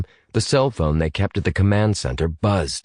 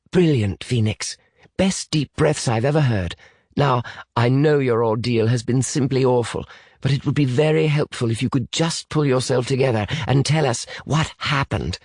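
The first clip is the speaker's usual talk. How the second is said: higher, proper British voice; encouraging British boy; 6 semitones higher than usual. Acted. British boy coaxes a shaken child to explain, encouraging. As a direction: praise and coax a child, in a British accent.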